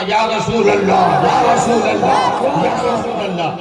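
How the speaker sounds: loud devotional cry. Acted. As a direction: cry it out for a whole gathering to join. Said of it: "जोर से शुरू करना".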